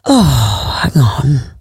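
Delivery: Catherine Zeta-Jones voice. Real, not acted. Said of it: "A K A Catherine Zeta-Jones".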